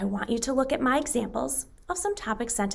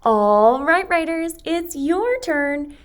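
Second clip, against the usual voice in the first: 1 loud call to class; 7 semitones higher, 7 dB louder, much slower than usual. Real, not acted. Teacher calls class to start.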